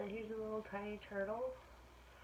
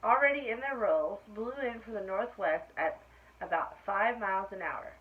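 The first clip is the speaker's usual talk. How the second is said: lively, louder read-aloud voice; story reading to children; 10 dB louder than usual. A narrator's reading. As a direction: read it brightly to a class of kids. Reading picture book aloud.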